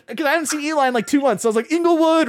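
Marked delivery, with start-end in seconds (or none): 1.7-2.3 high, loud cheer of the place name; excited shout. Retold or imitated. Speaker deciding to drive out, loud excited cheer. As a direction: announce it like a rallying cry. Same call repeated.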